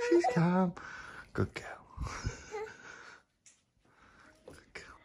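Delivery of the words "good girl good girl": soft whisper so as not to disturb. Real, not acted. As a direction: whisper it to the settling dog. Whispering while the dog settles.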